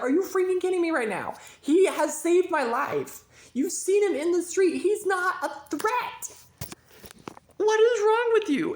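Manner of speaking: furiosa, aguda y fuerte; indignada. Retold or imitated. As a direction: reclamo indignado, subiendo la voz. Julie argues with her father.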